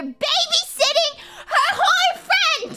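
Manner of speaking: high, loud incredulous ranting; exasperated outburst. Real, not acted. Streamer appalled at how she treats her date, exasperated shouting. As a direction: yell it in hammed-up outrage, voice way up.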